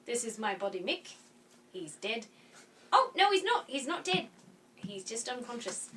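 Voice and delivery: Korg. Acted. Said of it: "give us your best Korg voice".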